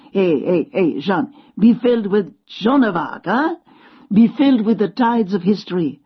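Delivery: put-on eager French-accented urging; old Frenchman rousing the girl, French accent. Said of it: "He had a French accent".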